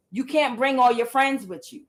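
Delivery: ordinary talk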